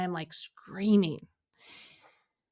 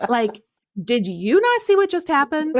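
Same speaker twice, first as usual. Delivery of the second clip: high, loud, indignant sass; sassy; 7 semitones higher, 9 dB louder than usual. Retold or imitated. Woman demanding God see how she was overlooked, sassy. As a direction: challenge God with exaggerated outrage. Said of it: "I was totally sassy with God".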